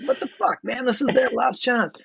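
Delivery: high-pitched, exasperated mock protest; retold pissed-off outburst. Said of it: "so pissed off at him".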